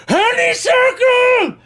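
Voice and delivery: very high loud slow pixie wail; pixie cry. Acted. Pixie calling fallen Honeysuckle, high loud wail. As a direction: call out for a fallen friend. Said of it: "You do".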